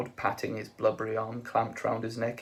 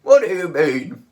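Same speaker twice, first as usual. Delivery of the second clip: very loud, raised, dim growl; Vogon guard; 7 semitones higher, 15 dB louder than usual. A narrator's reading. Vogon guard puzzles at Ford, very loud.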